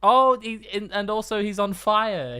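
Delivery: high, loud excited guessing; shouting pictionary guesses. Real, not acted.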